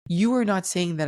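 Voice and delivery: normal talking voice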